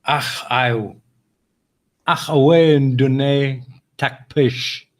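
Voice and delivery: slow, halting try at Scots; attempted Scottish accent. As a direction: sound out the Scots phrase word by word, clumsily. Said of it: "See, I can't do it".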